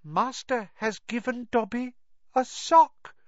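high squeaky elf, awestruck; house elf in wonderment. Acted. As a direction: marvel at it like a small elf. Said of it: "said the elf in wonderment".

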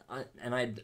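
normal talking voice

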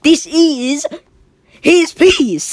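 high, loud, urgent protest; insistent protest. Retold or imitated. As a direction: insist it's his piece, loudly. Jack protests to police.

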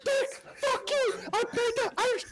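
very high mock yelling; bully the cook in a comically strained rage; hammed-up frantic fury; demanding waiter. Acted waiter demanding forgotten order.

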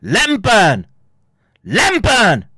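very loud, high yell with a long fall; high, drawn-out dismissive shout; ranting yell. Acted. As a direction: holler it out in scorn. Repeated shouted outburst.

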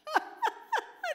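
carcajada aguda de burla; ríete como el diablo que se burla; risa burlona del diablo. Acted. Satanás se ríe del creyente. (el diablo se ríe del creyente, burlón)

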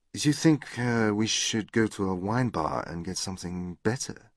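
put-on soft, hesitant French-accented suggestion. A narrator's reading. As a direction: suggest it gently as a Frenchwoman speaking English. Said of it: "in an attractive French accent".

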